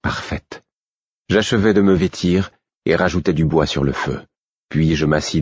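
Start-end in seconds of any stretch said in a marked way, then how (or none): 0.0-0.6 écho à peine audible; répète le mot pour lui-même, tout bas; soufflé. A narrator's reading. Il répète son mot, seul. (murmure rêveur)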